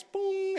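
high-pitched bell boom; imitated bell. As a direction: ring it out on a high tone.

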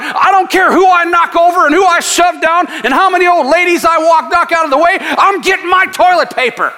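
fired-up shouted preaching. Acted. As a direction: hammer it like a pulpit climax. Preacher mocking panic buyers.

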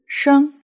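"sheng" held high and level; first-tone "sheng". Shown as a demonstration. Teacher teaching give birth, "sheng" in first tone. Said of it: "first tone".

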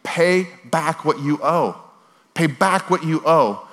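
acted creditor's slow, insistent demand; menacing demand. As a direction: press each word as a slow threat. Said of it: "begins to choke him and say".

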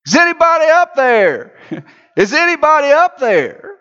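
high calling up from the bottom of a well; a man calling up a well. Acted. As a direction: shout upward for help. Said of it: "he started calling out".

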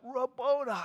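retold scream. Mary crying out to Jesus in Aramaic, retold scream. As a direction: cry the name out, acting her scream. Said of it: "just screams out".

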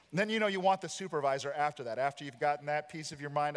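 ordinary talk

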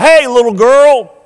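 bully's taunting call-out. Retold or imitated. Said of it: "That boy called out".

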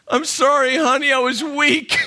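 put-on high, guilty little-boy apology; sheepish apology. Caught by his wife, sheepishly apologizing. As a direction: plead like a scolded kid. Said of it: "I'm like".

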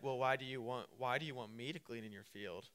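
low, soft, fishing question; coy probing. Acted. Ruth fishes for why he wants her there, coy and soft. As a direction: ask softly, beating around the bush. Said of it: "you're kinda beating around the bush".

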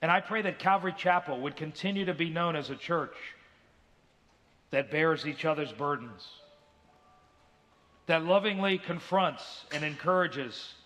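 lightly voiced closing prayer; pastoral prayer. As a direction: pray over the congregation, unhurried.

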